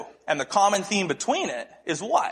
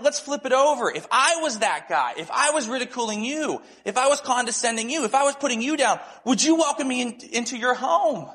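higher-pitched, building rhetorical question; retold insistent challenge; 7 semitones higher than usual. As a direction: press the friend point by point. Retold challenge to his friend.

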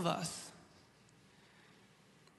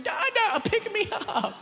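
very high, loud mock toddler wail; hammed-up whiny child plea; crying toddler; 5 dB louder, slower than usual. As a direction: imitate a little boy begging to be held. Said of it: "At this point, he's crying".

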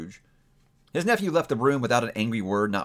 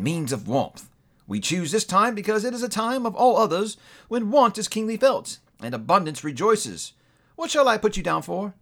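lightly voiced courteous gentleman, somewhat higher; polite charity gentleman; 7 semitones higher than usual. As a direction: ask for the poor with gentle earnestness. Gentleman asks for donation.

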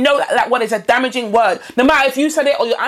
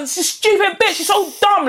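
higher-pitched burst of scorn; mock contempt; 7 semitones higher than usual. Host acts out insults that slip out, scornfully. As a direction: blurt the insult with mock disdain.

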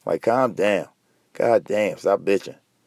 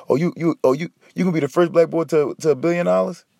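higher mock taunt; 6 semitones higher than usual. Mocking rich athletes.

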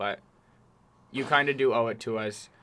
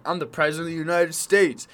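louder, higher mock-presidential announcement; imitating a self-important boast; mock proclamation; 5 semitones higher, 5 dB louder than usual. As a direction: declare it grandly, raised voice. Acted imagined grand declaration.

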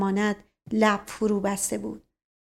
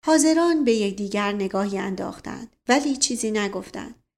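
usual speaking voice

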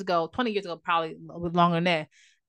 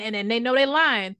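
usual speaking voice

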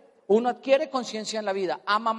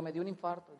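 quejido lloroso; 4 semitones lower, 12 dB quieter than usual. Retold or imitated. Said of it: "chillón".

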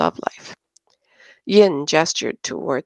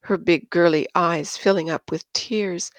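ordinary delivery

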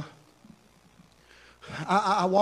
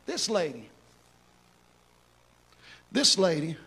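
much softer, low, tender appeal; soft altar-call preaching; 4 semitones lower, 8 dB quieter than usual. Acted. Pastor closing the sermon to the congregation, soft and tender. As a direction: draw them in gently, almost hushed.

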